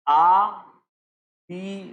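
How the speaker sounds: very slow, separated syllables आ, पी; teacher drawing out each sound; word broken into sounds. Shown as a demonstration. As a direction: sound out आ, पी with a long gap between. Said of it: "इसको break करेंगे".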